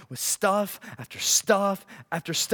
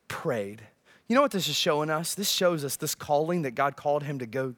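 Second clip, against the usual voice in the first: ordinary talk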